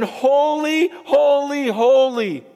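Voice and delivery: high ringing cry of holy; one angel crying to another, a loud high cry; screaming angel. Acted. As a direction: call it out like an angel in awe. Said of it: "one is screaming to the other angel".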